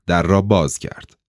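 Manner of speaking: ordinary delivery, nothing marked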